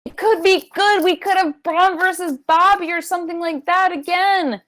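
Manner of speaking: high-pitched exasperated ranting; very mad and venting it; worked-up anger. Real, not acted. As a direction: complain in a high, insistent whine. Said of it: "very mad, angry even".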